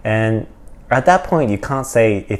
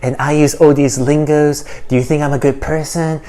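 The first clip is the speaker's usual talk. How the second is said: higher-pitched, eager do-gooder parody; mock progressive; 5 semitones higher than usual. YouTuber parodying a progressive begging for approval, higher mock voice. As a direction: put on an approval-seeking voice. Mocks a self-righteous progressive's talk.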